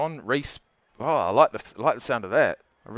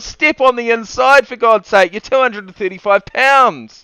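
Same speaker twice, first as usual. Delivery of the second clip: slow, louder, exasperated shouting; frustrated yell; 7 semitones higher, 8 dB louder than usual. Real, not acted. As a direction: plead with the player, loud and slow. Yelling instructions at his player.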